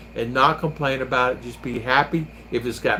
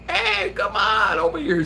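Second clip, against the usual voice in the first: New York holler; 4 semitones higher than usual. Retold or imitated. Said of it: "You know, New York".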